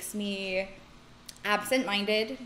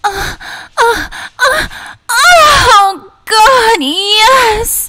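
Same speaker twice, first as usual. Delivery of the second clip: high, loud, breathy moans; fake orgasm; 13 semitones higher, 19 dB louder, much slower than usual. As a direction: ham up a faked climax. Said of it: "replicate that into this microphone".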